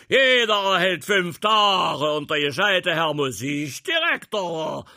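furious high roaring tirade; wütendes Brüllen. Acted. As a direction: keep raging at the lazy band. Prince continues raging at band. (wütend brüllender Feldmarschall)

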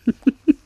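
short, high-pitched chuckle; brief burst of amused laughter. Real, not acted. Captain joking with radio host about his invitation, short high laugh.

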